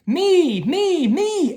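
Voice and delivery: put-on high, loud children shouting me; eager shout. A narrator's reading. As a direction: shout like eager kids wanting a turn. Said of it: "everyone shouted all at once".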